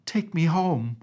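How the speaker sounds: put-on high, loud childish crying plea; tearful plea. A narrator's reading. Princess begging her nurse to take her home, tearful. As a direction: beg to be taken home, near tears. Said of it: "cried the princess".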